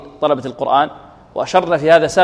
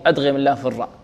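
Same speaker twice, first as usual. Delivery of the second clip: ordinary talk, nothing marked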